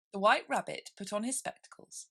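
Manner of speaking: ordinary delivery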